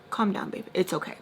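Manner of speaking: soft, hushed pretend comforting; soothing. She talks to her younger self, softly soothing. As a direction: reassure gently under your breath.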